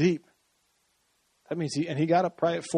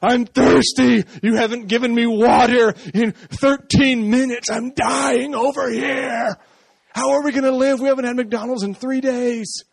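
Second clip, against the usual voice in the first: whiny dying body; 7 semitones higher, 4 dB louder than usual. Pretend. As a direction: whine like a body dying of thirst. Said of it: "it's like".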